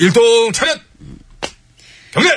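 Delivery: military command. Acted.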